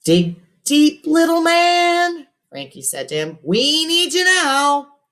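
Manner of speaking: high, slow rallying urge; coaxing coach. A narrator's reading. Frankie urging Robert to keep going, rallying. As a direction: pump the kid up.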